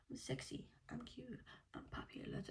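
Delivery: whispered movie lines, soft and hushed; breathy quoted whisper. Retold or imitated. Whispering a favourite film quote to viewers.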